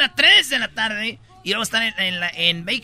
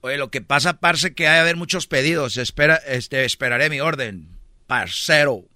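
put-on Colombian accent; 8 semitones lower than usual. A Colombian waiting for his order, lower Colombian accent. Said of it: "un colombiano, y dice".

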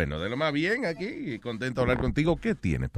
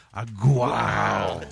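sarcastic wow; 8 semitones lower, 6 dB louder than usual. Acted. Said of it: "No cabe otra expresión".

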